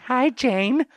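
put-on high-pitched child voice, rising; little girl. Girl answering the door to Jane, little girl voice. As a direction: pipe up like a small girl. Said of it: "the girl answers the door".

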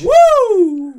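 loud high cheer; excited whoop. Real, not acted. Cheering on his return.

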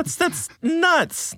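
high, slow amazed exclaim; excited. Real, not acted. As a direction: marvel at it, drawn out high. Amazed reaction.

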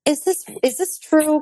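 high, slow self-questioning; doubting question. Retold or imitated. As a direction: ask it high and slow, unsure. Mimics own doubting question.

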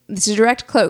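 normal talking voice

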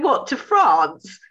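slow, high, loud stunned question; disbelieving check. Retold or imitated. Checking with Louise in sewing class, slow, loud disbelief. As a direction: stretch it out, unable to believe it. Double-checking the France swim.

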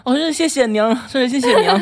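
high, loud over-the-top gratitude; gushing mock thanks. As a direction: lay the thanks on thick.

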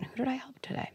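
whispered inner voice; soft unvoiced whisper of a remembered question. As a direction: breathe it like a thought in the head. Inner voice heard by kid.

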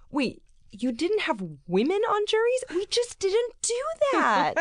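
future generation mocking. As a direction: squeak out the disbelief of a future kid. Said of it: "it's gonna be like".

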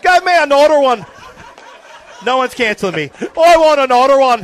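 mock drunk Irishman, high and loud; drunk Irish accent. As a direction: ham up a drunk Irish barfly calling for another. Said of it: "I'm just doing an Irish accent".

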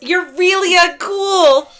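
high, loud and slow whine; bratty kid voice. Retold or imitated. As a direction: whine it like a sulky teen. Echoing the kid's line.

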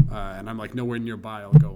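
ordinary talk, nothing marked

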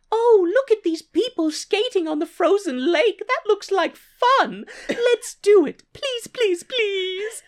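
put-on very high-pitched squeaky girly voice; hammed-up ditzy showgirl begging. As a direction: plead excitedly in a put-on falsetto. Showgirl begs to go skating, acted.

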